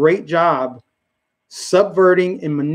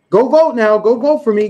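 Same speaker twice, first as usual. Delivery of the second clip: higher, louder campaign plea; mock politician; 6 semitones higher, 4 dB louder than usual. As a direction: imitate a politician pushing for votes. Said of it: "Politician is like a pull string doll".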